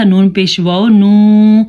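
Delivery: نو with a long held "oo"; stretched vowel. Shown as a demonstration. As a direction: draw the vowel of نو out long and steady. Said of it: "اس کو ہم نے کھینچ کے پڑھا". (نو کو کھینچ کے)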